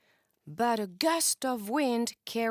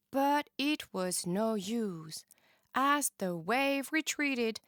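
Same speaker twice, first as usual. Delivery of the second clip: plain reading voice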